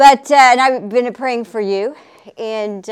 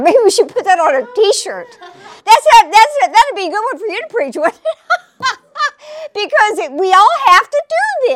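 laughing; 8 semitones higher, 5 dB louder than usual. Real, not acted. Laughing at her own joke.

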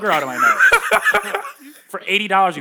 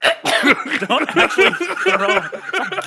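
laughing; 12 semitones higher, 3 dB louder, much slower than usual. Real, not acted. Laughing at friend gagging.

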